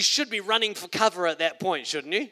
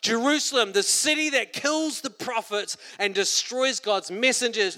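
raised, high call to the city; Jesus lamenting; 5 semitones higher, 3 dB louder than usual. Acted. Jesus crying over Jerusalem, raised and aching. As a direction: cry out the lament. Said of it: "He says".

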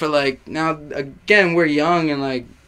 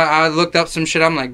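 normal talking voice

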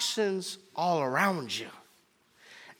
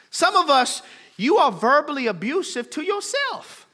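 raised, high-pitched preacher's cry; impassioned preaching; 7 semitones higher, 6 dB louder than usual. Real, not acted. Preacher confessing toxic qualities to the church, impassioned preaching. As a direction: press the confession home with force.

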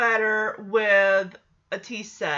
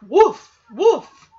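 two loud, high-pitched woofs; imitated dog bark; 4 semitones higher, 11 dB louder than usual. Podcaster mimics the dog from the show, barking loudly.

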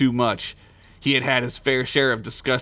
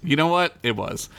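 ordinary delivery

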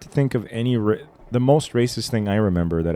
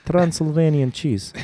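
put-on Transylvanian accent; 8 semitones higher, slower than usual. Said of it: "Bela Lugosi".

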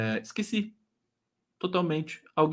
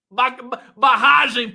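laughing, higher and louder; 6 semitones higher, 8 dB louder, slower than usual. Real, not acted. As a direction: laugh out the word. Laughs while reading a comment.

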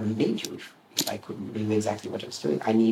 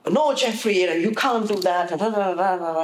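high, loud mock scolding of Jeffrey; imitating a scolding; 9 semitones higher, 4 dB louder than usual. Teller mimics the outburst at Jeffrey, high and loud. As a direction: act out the rant, pitched high and loud.

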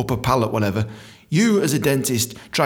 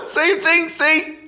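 high, laughing words; 13 semitones higher, much slower than usual. Real, not acted. Comic explaining his rude joke, laughing high. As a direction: talk on while cracking up.

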